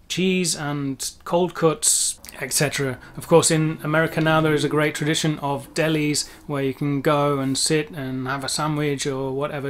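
usual speaking voice

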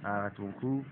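usual speaking voice

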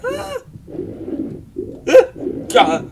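high yelped pretend screams; three sharp staged screams; mock scream. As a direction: shriek as if the alligator just popped out. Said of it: "and I'm gonna go".

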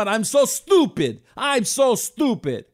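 mock self-scolding in a Persian accent. Casino owner scolding his younger self, Persian accent. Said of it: "I went to the accent for a minute".

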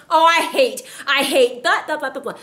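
hammed-up whiny hating; mock griping. Preacher acting out a griping churchgoer, mock gripe. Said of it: "these are examples".

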